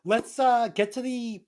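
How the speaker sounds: high, slow, drawn-out lead-in; high playful announcement; playful segue. Pretend. Playful segue to next scene.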